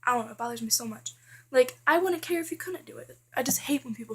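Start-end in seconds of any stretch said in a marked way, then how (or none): none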